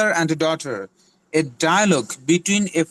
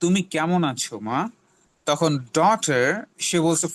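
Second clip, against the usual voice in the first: usual speaking voice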